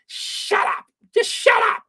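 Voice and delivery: high shrieking shut up; mock shout. Viewer yelling at the TV host to shut up. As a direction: yell it at the TV.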